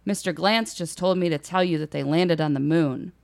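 normal talking voice

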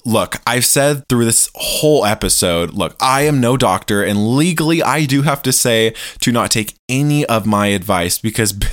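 usual speaking voice

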